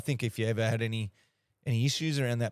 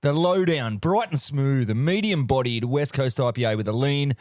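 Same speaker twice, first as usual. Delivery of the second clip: loud, hammed-up pommie beer-ad voice; over-the-top English ad read; mock British accent; 4 semitones higher, 4 dB louder than usual. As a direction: sell the beer like a cheeky Englishman. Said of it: "my fellow pommies".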